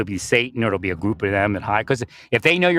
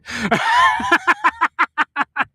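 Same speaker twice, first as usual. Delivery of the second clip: shrieking laugh. Real, not acted. Laughing at his own story.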